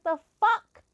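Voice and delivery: high, loud burst of disbelief; outraged yelp, retold; ticked off. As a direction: pitch leaps up in angry shock. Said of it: "I was so fucking ticked".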